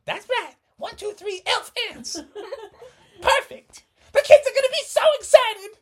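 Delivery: high, squeaky cheerful mom voice; giddy team mom. Acted. Missus Frogerson cheering the boys on, high and giddy.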